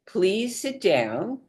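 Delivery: calm slow request to sit; unhurried soft instruction; slow and gentle. Shown as a demonstration. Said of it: "what you want to say is".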